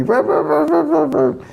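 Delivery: high yappy barks, rah rah rah; imitated dog barking. Teller imitates the legless dog barking up the tree, high yappy barks. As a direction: yap like a little dog at the tree.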